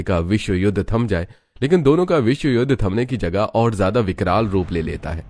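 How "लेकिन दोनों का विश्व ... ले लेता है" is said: plain reading voice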